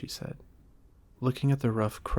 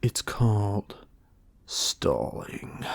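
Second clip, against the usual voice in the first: slow unvoiced wolf whisper-growl; a suspicious beast muttering; much slower than usual. A narrator's reading. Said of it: "growled Wolf".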